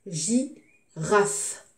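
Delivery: GI, pause, RAFE; teacher sounding out "girafe" in pieces; syllable by syllable. Shown as a demonstration. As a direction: split "girafe" into two separate beats. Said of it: "je découpe le mot".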